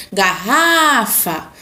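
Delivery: garrafa with a long held RRA; calling out. Shown as a demonstration. Teacher giving examples of tonic syllables, calling out. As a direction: stretch the RRA of garrafa, like a call. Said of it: "fica por mais tempo na minha boca".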